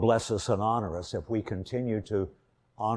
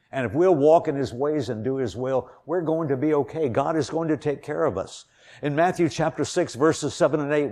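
louder and higher, driving sermon voice; full-force preaching; 4 semitones higher, 4 dB louder than usual. Acted. Preacher urging the congregation to trust God, fervent. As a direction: preach it out with rising fervour.

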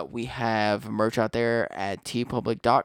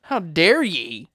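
slow, higher mock outrage; hammed-up, drawn-out affront; mock indignation; 4 semitones higher, 4 dB louder, slower than usual. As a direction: play offended in slow, old-timey grandeur. Mock offence at downplayed episode.

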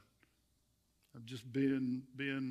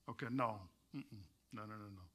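softer, deliberate no's; soft firm denial; 8 semitones lower, 11 dB quieter than usual. Real, not acted. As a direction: quietly shake it off, no no no. Rejecting the wrong order.

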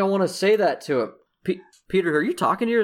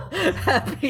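laughing. Real, not acted. Laughing high.

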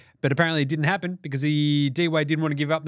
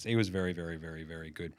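fading; 11 semitones lower, 10 dB quieter than usual. Acted. Trailing emphatic verys.

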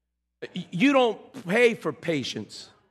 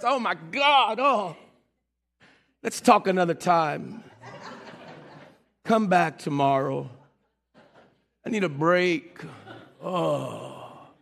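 mock-exhausted groaning, sinking lower; weary exasperation; 5 semitones lower, slower than usual. Acting out someone worn down by immature talk, exasperated. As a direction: sigh it out, done with the conversation.

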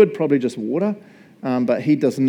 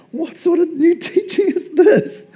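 much higher, slow, laughing amazed question; asked excitedly; 4 dB louder, slower than usual. A narrator's reading. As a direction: gasp it out in amazed delight. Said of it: "they asked excitedly".

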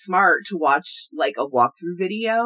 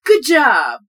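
bright high announcer "Good job!"; game voice; 7 semitones higher, 9 dB louder than usual. Retold or imitated. S1 quoting the game's praise, high and loud. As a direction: imitate the game's cheery praise.

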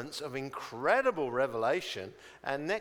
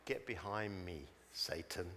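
low, soft, stern dismissal; quiet rebuke; 8 semitones lower, 9 dB quieter than usual. Acted. Jesus rebukes Peter, acted.

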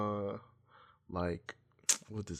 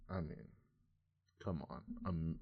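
ordinary talk

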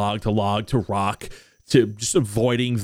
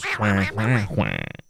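duck quacks; 2 semitones lower, much slower than usual. Acted. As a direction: quack back at them.

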